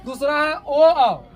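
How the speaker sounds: a teacher sounding out ओ आओ; slow loud demo. Shown as a demonstration. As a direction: say ओ आओ slowly and loudly for the class. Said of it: "teacher demonstrates vowel sounds".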